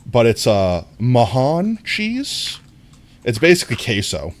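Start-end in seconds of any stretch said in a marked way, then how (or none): 1.0-2.6 Mahan said very slowly and higher; slow, careful Mahan; careful pronunciation. Shown as a demonstration. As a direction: sound out Mahan carefully, unsure. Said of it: "I'm saying it wrong".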